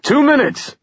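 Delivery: high shout from down the hall. A narrator's reading. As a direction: call it out loud and high. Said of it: "a man shouted".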